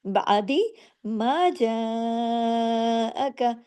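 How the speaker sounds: slow, drawn-out tajweed chant; Quranic recitation. Shown as a demonstration. Teacher reciting a verse for students, slow chant. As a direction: recite it slowly with full tajweed.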